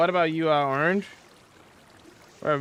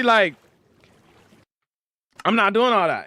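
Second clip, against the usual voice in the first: dismissive; 8 semitones higher, 5 dB louder than usual. Real, not acted. Dismissing deep dish pizza.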